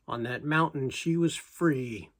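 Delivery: ordinary delivery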